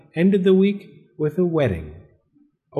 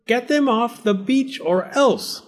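shouting lifeguard; 12 semitones higher than usual. A narrator's reading. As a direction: bark the rule at them. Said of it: "the lifeguard shouted".

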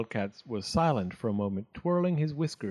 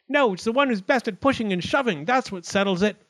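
high, insistent little voice; quarrelling acorn; 8 semitones higher, 4 dB louder than usual. A narrator's reading. Another acorn joins the racket, high and argumentative. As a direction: cut in high to settle it. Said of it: "The acorns were making such a racket".

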